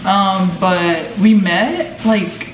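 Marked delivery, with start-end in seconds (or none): none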